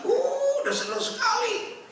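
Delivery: very high, loud squealing delight; mock giddy joy. Preacher mimics a giddy lover, high squealing delight. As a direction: squeal with overblown happiness.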